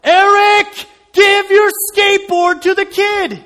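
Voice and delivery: a divine voice shouting inside his head. Retold or imitated. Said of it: "but this time even louder".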